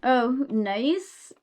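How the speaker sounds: ordinary talk, nothing marked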